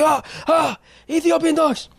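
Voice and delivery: high mock-panicked cry; night-terror yelp. Waking from night terrors, dogs attacking.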